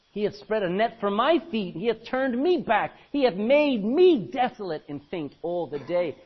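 loud, declaiming King James reading; scripture reading. Acted. Preacher reads Lamentations to congregation, declaiming. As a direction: proclaim the verse to the hall.

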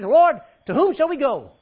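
loud, high, slow cry of Peter; Peter's loud plea. Acted. Simon Peter pleading with Jesus, a loud slow cry. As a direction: proclaim it like Peter pleading with his Lord.

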